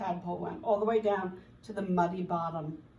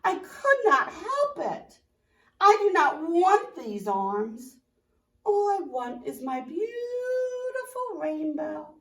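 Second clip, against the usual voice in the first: much higher, loud, slow crying plea; 6 semitones higher, 6 dB louder than usual. Acted. Tadpole begs caterpillar, high slow crying plea. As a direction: sob out the begging.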